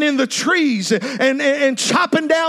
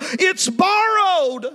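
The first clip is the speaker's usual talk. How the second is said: anguished cry; 6 semitones higher than usual. Acted. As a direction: cry it out in distress. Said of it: "he cried out".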